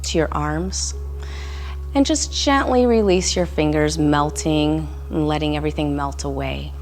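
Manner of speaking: calm meditation voice with long pauses; guided meditation. Real, not acted. Instructor guiding listeners through a body scan, calm meditation voice. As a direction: lead a body scan gently, leaving room to breathe.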